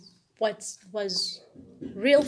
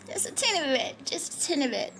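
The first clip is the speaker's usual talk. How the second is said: high, small, playful voice; 7 semitones higher, faster than usual. Real, not acted. Admitting she cares a little about looks, playful high voice. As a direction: squeeze it up high for a tiny amount.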